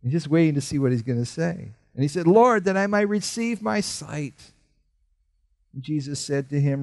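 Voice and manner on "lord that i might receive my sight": pleading beggar. Acted. Beggar's plea voiced.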